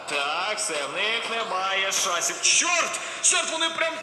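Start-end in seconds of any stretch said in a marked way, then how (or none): 2.4-3.9 high, loud cry of alarm; startled game shout. Acted. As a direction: yell as the bombs land. Bombed in the game.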